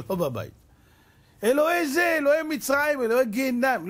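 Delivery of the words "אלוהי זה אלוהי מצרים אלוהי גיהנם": frantic calling. Retold or imitated. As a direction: fire off god after god in panic. Manasseh calling idols in pot. (קריאות נואשות וגבוהות)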